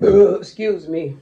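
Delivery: loud burp, then excuse me. Real, not acted. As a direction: belch it out loud. Burping while eating.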